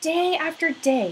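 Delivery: ordinary delivery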